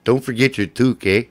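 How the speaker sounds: hammed-up Canadian accent; mock Canadian hoser accent. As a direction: play up the Canadian "eh". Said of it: "You said that like a native".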